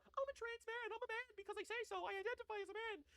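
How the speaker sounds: a sneering falsetto impression; mocking high voice. Streamer parodying the lawyer, high mocking voice. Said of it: "play the woman card".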